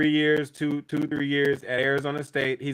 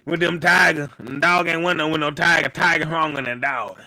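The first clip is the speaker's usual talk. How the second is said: lightly hammed-up Southern coach accent; teasing imitation of a put-on Southern accent; Brian Kelly fake accent. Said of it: "that foreign accent from Waterboy".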